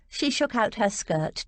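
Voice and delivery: ordinary delivery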